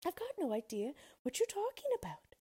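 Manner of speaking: put-on cross girl. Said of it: "said Dorothy crossly".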